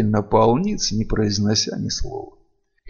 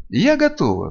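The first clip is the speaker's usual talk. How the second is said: high-pitched ready exclamation; eager cry; 7 semitones higher than usual. A narrator's reading. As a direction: cry out your readiness. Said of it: "вскричала Эйвиль".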